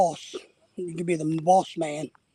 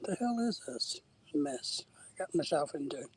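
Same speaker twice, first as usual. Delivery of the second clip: muttering to himself; 4 semitones lower, 11 dB quieter than usual. Real, not acted. Muttering at tangled grass.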